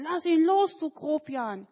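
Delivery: loud, high scolding yell; children yelling at Manni to let go; angry kids. Acted. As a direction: shout at the bully. Kids rescuing the boy.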